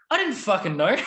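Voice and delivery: high-pitched retold disbelief; comic, exclaimed admission; astonished confession. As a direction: imitate a shocked mate, voice shooting up. Friend's shocked confession, retold.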